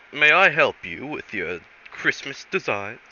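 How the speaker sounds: a hint of a refined English hunter; mildly posh Hammerlock voice; posh gentleman. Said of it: "horrible, horrible impression of him".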